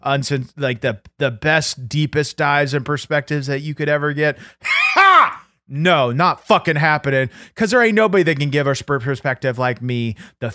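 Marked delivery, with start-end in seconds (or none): 4.6-5.4 sharp, high burst of a scoffing laugh; loud mocking laugh. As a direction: scoff with one big laugh.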